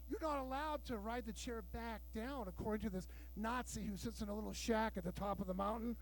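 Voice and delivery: ordinary talk, nothing marked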